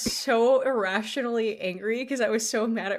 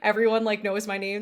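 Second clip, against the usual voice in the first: ordinary talk, nothing marked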